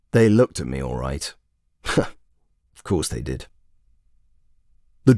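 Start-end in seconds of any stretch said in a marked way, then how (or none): 1.8-3.5 low, slow smug chuckle; chuckling to himself; laughing. Real, not acted. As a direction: savour it with a quiet laugh.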